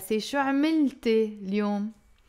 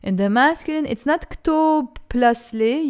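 usual speaking voice

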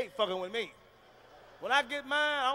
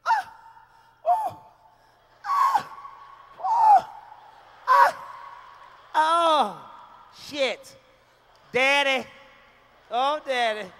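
high shrieking oh and ah, hammed up; faked female orgasm; 5 semitones higher, 8 dB louder, much slower than usual. Woman faking a climax for her man, shrieking high. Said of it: "A woman can fake a nut".

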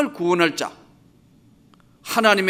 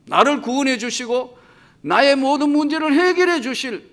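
higher, louder crying-out prayer; fervent plea; 5 semitones higher, 4 dB louder than usual. Acted. Preacher crying out to Jesus, higher louder plea. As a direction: lift the voice in pleading.

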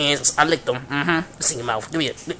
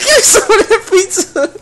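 high, louder mock whine, laughing through it; mocking her begging; 11 semitones higher, 7 dB louder than usual. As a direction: imitate her pleading, hammed-up. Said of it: "She's like".

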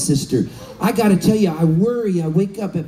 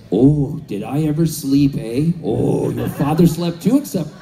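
put-on much lower, folksy old mother voice; chatty old mom; 7 semitones lower than usual. Mother telling her son about her night, low and folksy. Mom reports sleeping after pot.

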